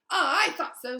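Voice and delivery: high, loud knowing exclamation; a vain wizard claiming he knew; smug Lockhart. Acted. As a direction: pounce on it as if obvious. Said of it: "said Lockhart".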